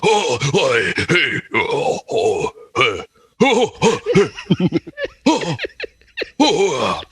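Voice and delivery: wordless British oi, hey, eh noises climbing high; hammed-up British guttural grunts. Shown as a demonstration. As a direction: huff and grunt like a stout English squire. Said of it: "voice you can do and not say a single word".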